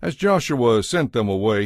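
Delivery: usual speaking voice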